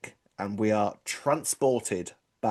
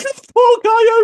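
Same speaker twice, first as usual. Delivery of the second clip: high, loud hammed-up wail; mock sobbing wail; 12 dB louder, slower than usual. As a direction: wail it like a crying fan. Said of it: "and I'd be like".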